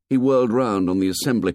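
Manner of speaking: ordinary delivery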